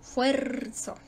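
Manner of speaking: fuerzo with a long, leaning FUER; teacher sounding out FUER in fuerzo; stress on FUER. Shown as a demonstration. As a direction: draw out the stressed syllable in fuerzo. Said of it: "la más fuerte es la tónica".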